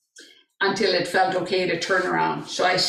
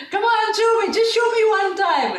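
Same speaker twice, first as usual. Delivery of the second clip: imitating a pleading son, high-pitched; high, coaxing retold plea; insistent begging; 12 semitones higher than usual. Son keeps begging his mother.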